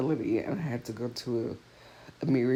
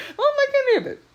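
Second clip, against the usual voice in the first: high, squeaky words through laughter; real laughing; 7 dB louder, much faster than usual. Real, not acted. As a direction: crack up while complaining. Cracks up over her own complaint.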